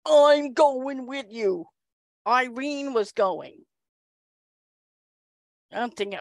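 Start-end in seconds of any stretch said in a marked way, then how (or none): 0.1-1.7 slow, drawn-out mimicked declaration; insistent colleague. Colleague flings arms into the elevator, slow hammed-up insistence. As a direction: stretch every word in mock determination. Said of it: "fling in and go".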